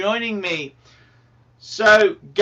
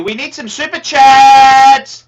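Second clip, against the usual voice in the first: slow, loud call for super chats; loud plea; 5 semitones higher, 13 dB louder than usual. Real, not acted. Streamer to his chat, a slow loud appeal. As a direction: hammer it at the viewers.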